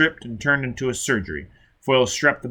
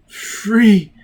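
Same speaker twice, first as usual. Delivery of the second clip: exultant cry; 8 semitones higher, 8 dB louder than usual. A narrator's reading. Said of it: "he muttered".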